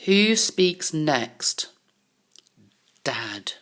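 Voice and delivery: ordinary talk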